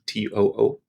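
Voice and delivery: ordinary talk, nothing marked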